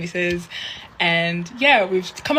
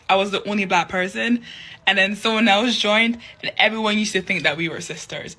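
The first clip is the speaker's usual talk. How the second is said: ordinary talk, nothing marked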